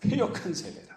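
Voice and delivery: normal talking voice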